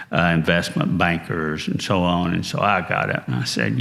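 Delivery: ordinary delivery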